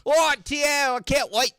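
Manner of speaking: high, loud gushing "oh yeah"; mock excitement. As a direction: gush with fake thrill. Mocking the word squall.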